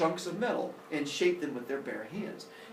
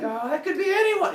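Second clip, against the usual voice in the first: high, loud not-me voice; feigned innocence; 6 dB louder than usual. Acted. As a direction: play innocent like a guilty kid. Guilty sons feign innocence.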